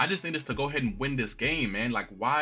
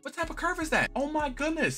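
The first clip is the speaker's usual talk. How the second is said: very high-pitched, exasperated cry; outraged disbelief. Real, not acted. Gamer questioning the game's curve, exasperated. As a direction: squeal the complaint in disbelief. Outraged at a rigged-seeming comeback.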